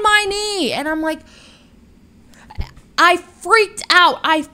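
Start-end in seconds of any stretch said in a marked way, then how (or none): 3.0-4.2 loud, slow, higher burst; freaked out. Real, not acted. Telling viewers about the tarantula on her knee, loud excited burst. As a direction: relive the panic out loud.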